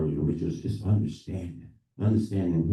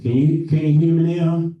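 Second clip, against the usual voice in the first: raised, higher-pitched projecting voice; speaking louder; 12 semitones higher, 12 dB louder than usual. Real, not acted. Speaker asked to speak up checks with listeners, much louder. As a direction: project loudly to check they can hear. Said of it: "Could you speak a little louder".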